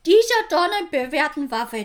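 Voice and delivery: usual speaking voice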